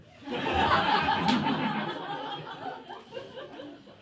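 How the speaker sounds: big burst of real laughter, high and bright; loud laugh. Real, not acted. Laughing hard at the joke just retold to the class. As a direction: crack up at your own punchline. Own joke falls flat.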